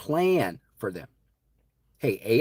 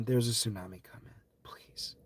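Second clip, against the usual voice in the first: hushed plea; 9 dB quieter than usual. Acted. Pleading warning to listeners.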